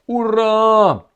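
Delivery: a loud, high victory cry of hurrah; triumphant cheer. Real, not acted. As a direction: shout it like a crowd cheering a hero. Cheering the minister's defiant words.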